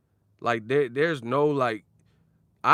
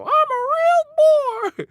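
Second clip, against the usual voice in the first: loud, drawn-out falsetto squeal; Pinocchio impression; 11 dB louder, much slower than usual. Mocking old media as a loud, high-pitched Pinocchio. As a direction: squeak it out like a puppet boy.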